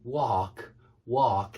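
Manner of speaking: "walk" said slowly as /wɑɹk/ with an American R; American accent. Shown as a demonstration. Teacher attempting the American walk, slow American accent. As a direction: drag out "walk" like an American, R added. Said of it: "that's my best effort at it".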